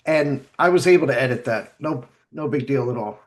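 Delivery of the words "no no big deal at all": usual speaking voice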